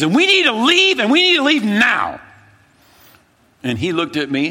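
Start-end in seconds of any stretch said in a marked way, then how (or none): 0.0-2.2 very high, loud, urgent shout; frantic insistence. Retold or imitated. Telling his friend they must leave now, a frantic shout. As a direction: insist on leaving at full volume.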